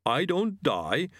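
slow, high creature voice; yeti echo. Acted. Yeti repeating the student's question back, slowly.

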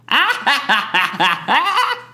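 high cackling villain laugh; evil laugh. Pretend. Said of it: "All right, your turn".

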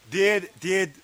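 ordinary delivery

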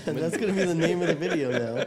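loud, high laughing speech. Real, not acted. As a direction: laugh while you say it. Laughing at the joke.

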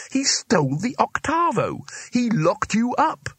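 furious he can't believe it; outraged outburst. Acted. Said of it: "exploded Rincewind".

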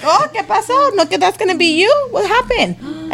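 high, mocking fake concern; retold gleeful needling; teasing. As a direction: taunt him in a singsong high voice. Mother teasing son who boasted earlier.

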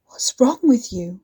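breathless shocked whisper; wide-eyed whisper. A narrator's reading. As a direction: whisper it, stunned. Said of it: "It came out as a wide-eyed whisper".

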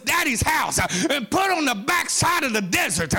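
shouted, rhythmic sermon climax at a high pitch; sing-song hollering preacher in full flight; full-force preaching. Acted. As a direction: preach it hard, whooping toward the crowd.

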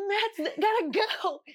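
high, drawn-out mock call; retold holler, high and slow; urgent announcement. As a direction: stretch it out slowly, up high. Acts announcing Matt rushing off.

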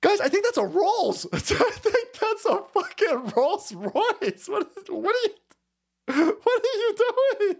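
high-pitched, cracking up in disbelief; laughing disbelief. Real, not acted. Host telling viewers it's a Rolls, laughing incredulously. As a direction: squeal it through real laughter. Spots a Rolls being wrecked.

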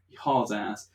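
normal talking voice

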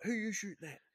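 high put-on voice of the squad in the building; mock squadmate. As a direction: call out high and alarmed.

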